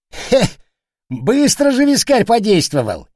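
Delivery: high, loud sniggering jeer. A narrator's reading. Soldier mocks his drinking comrade, sniggering. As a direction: tease in a high giggly voice. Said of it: "хихикнул Козёл".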